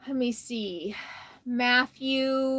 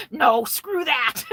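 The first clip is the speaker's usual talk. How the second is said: loud, drawn-out mock outburst between laughs; joking refusal; 3 semitones higher, 5 dB louder, slower than usual. Streamer jokes about the requested GPS video, loud mock refusal. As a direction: blurt it out slowly and loudly, only kidding. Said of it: "I mean, I'm joking".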